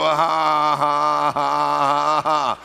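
loud sustained ha-ha-ha; defiant demonstrated laugh. Shown as a demonstration. Answering the devil's "they'll never change", forced laugh. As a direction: laugh it off loudly and deliberately. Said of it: "You go".

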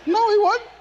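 high laughing no, he wasn't; laughing denial. Real, not acted. Preacher laughs off a Klan rumour about his granddaddy, laughing.

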